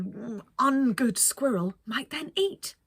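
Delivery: ordinary delivery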